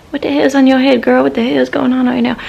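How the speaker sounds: a hint of a gossiping girlfriend; imagined viewer. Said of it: "I know what you're thinking right now".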